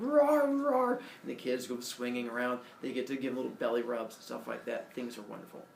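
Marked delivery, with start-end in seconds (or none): 0.0-1.0 high, cartoonish roar; playful roar. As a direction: roar like a cuddly toy bull. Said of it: "The bull's like".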